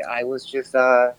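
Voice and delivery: ordinary talk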